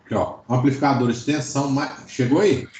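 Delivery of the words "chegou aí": ordinary talk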